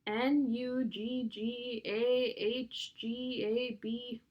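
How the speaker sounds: letters drawn out in an even chant; slow spelling out. A narrator's reading. Boy tests the puzzle backwards, slow spelling out.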